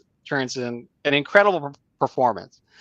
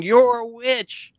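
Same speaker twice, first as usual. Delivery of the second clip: put-on higher, slow sing-song child taunt; imitating a taunting little boy; 8 semitones higher, slower than usual. Little boy taunting the cashier, higher, slow child voice. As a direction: drag it out like a kid teasing.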